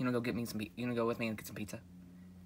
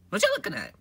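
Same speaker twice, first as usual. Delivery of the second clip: higher, louder take of the question; high squeaky voice; 10 semitones higher, 10 dB louder than usual. Acted. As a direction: push it up into a squeaky character voice.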